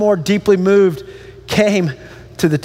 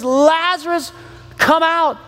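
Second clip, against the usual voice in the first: high-pitched call, no louder than talk; 7 semitones higher, slower than usual. Acted. Jesus calling the dead man from the tomb, calling out. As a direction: summon someone out, raised pitch, measured volume. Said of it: "Jesus called out in a loud voice".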